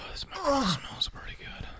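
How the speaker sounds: breathy joking whisper right into the mic; playful whisper. Pretend. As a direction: whisper it like a secret to the microphone. Whispering into the microphone.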